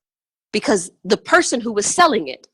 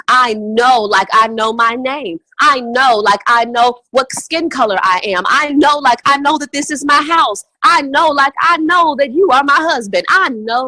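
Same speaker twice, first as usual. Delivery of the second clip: louder and louder, higher by the end; building conviction; 4 semitones higher, 4 dB louder than usual. Real, not acted. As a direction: swell into total certainty. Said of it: "building certainty declarations".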